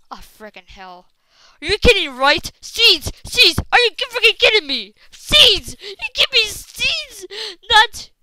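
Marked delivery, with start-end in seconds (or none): 1.6-8.1 high, very loud rant; exasperated high-pitched shouting; outraged yell. Real, not acted. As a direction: shout in disbelief at a useless reward. Outraged at being given seeds.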